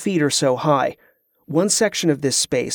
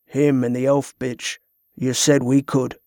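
emotionless; 2 semitones lower than usual. A narrator's reading. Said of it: "the lack of emotion in Garrick's voice".

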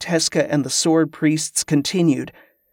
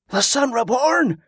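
voice from the crowd; 9 semitones higher than usual. A narrator's reading. Pilgrim hailing Tezcah from the crowd, high cry. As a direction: call it out high over a crowd. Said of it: "came a voice from the crowd".

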